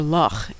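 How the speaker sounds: "loch" ending in a throaty Scottish rasp, not a k; throat-clearing ending. Shown as a demonstration. Teacher giving a Scottish example, "loch" with throat-clearing ending. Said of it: "a throat-clearing noise".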